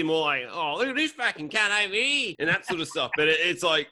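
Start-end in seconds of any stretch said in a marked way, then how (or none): 0.5-2.4 high, hammed-up Australian bogan voice; bogan accent. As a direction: put on a broad country Australian bogan. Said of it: "the bogan thing or country".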